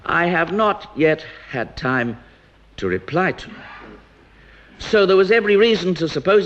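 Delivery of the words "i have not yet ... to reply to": "I have not yet had time to reply to" said slowly, word by word; emphasized words. Real, not acted. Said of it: "this I emphasize the words".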